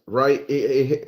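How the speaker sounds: normal talking voice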